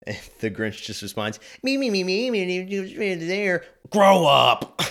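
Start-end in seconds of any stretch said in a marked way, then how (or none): 1.6-3.6 much higher, sing-song mock of a child; the Grinch mocks Cindy Lou in a high childish voice; mocking childish mimic. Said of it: "mimicking someone like a child". 3.9-4.8 much louder barked shout; the Grinch turns from mockery to yelling at her; sudden yell. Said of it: "yelling at them to grow up".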